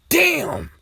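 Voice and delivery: high-pitched drawn-out exclamation; movie-scene yell. Retold or imitated. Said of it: "it's like that scene from the eighties".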